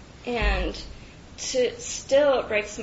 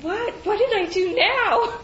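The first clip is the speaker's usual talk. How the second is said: high, loud puzzled question; baffled reply; 11 semitones higher, 6 dB louder than usual. Retold or imitated. Answering her yelling dad, high and puzzled. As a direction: ask it high and bewildered. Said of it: "and I said".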